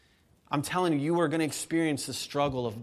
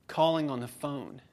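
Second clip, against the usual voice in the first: ordinary talk, nothing marked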